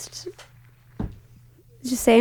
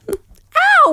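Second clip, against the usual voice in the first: pained yelp; 4 dB louder than usual. Real, not acted. Got hit.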